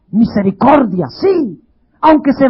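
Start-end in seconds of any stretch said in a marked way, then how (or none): none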